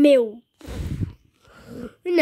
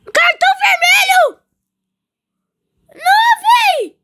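much higher, louder despairing yell; despairing shout; 12 semitones higher, 8 dB louder than usual. Real, not acted. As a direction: scream in disbelief. Red card given.

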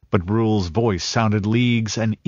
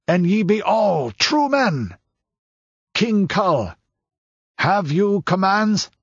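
higher, ringing proclamation to the crowd; rally the men, then shout; proclaiming elder; 6 semitones higher than usual. Acted. Kanu proclaims to the guards.

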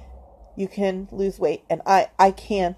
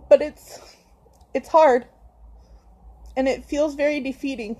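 higher, voice breaking with real tears; crying; 6 semitones higher than usual. Real, not acted. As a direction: push through the crying. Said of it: "Why is she crying at me".

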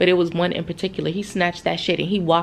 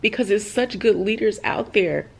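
emotional, holding back tears; 3 semitones higher than usual. Real, not acted. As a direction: push on haltingly while choked up. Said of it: "I'm so sorry. Wait a second".

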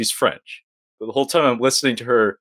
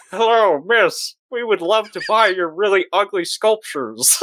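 higher, formal caller voice, lightly hammed up; mock phone caller; 8 semitones higher than usual. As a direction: make a put-on, over-polite offer. Plays the callers to the actress.

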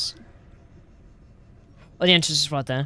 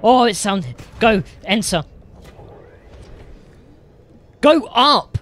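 loud, higher urgent commands; frantic shouting; 7 semitones higher, 8 dB louder than usual. Real, not acted. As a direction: yell at yourself to hurry in. Rushing into the entrance in panic.

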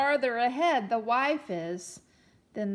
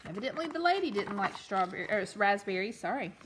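usual speaking voice

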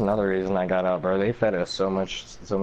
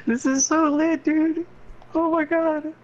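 high, excited gamer yelling; hyped and frantic, pitched way up. Real, not acted. Chaos of people chasing him.

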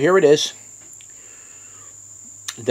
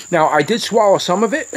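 ordinary delivery

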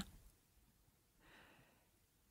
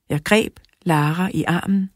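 plain reading voice

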